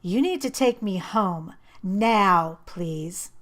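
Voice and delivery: cold fury, clipped command; angry demand. A narrator's reading. Girl ordering boyfriend to drive her home, angry.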